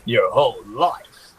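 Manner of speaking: slow, menacing game-villain voice; voice-actor villain. Pretend. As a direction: play a hammed-up villain, drawing out each threat. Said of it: "I also wanna be a voice actor".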